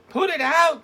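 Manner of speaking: very high, loud urgent shout; urgent cry. Acted. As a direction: shriek the order in panic. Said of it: "cried Ruby".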